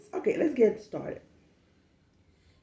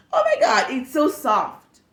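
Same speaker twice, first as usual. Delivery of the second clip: gushing coworker; 6 semitones higher, 7 dB louder than usual. Retold or imitated. Coworker gushing over her wig.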